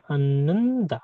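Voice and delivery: drawn-out 'Ananda', long held n in the middle; slow model. Shown as a demonstration. Teacher showing that h is not pronounced, slow model of 'Ananda'. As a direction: stretch 'Ananda' out for learners.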